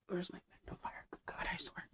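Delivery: soft whispered grumble to herself; whispered aside. Real, not acted. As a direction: drop to a whisper while searching. Muttering while reaching off-mic.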